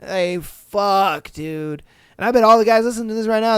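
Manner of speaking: usual speaking voice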